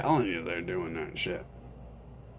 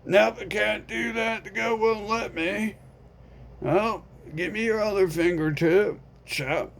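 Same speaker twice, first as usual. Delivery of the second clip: a higher, offhand friend voice; lightly voiced friend; 11 semitones higher, 4 dB louder than usual. Friend refusing to help, mildly raised voice. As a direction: shrug it off, unbothered. Skit, indifferent friend answers.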